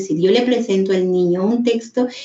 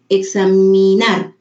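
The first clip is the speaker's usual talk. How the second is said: "examinar" said slowly and a bit louder as the full word lands; word finally read whole; 4 dB louder, slower than usual. Shown as a demonstration. Teacher's imitated child finally getting the word, slow and firmer. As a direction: land on "examinar" at last. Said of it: "Si el niño está leyendo lento".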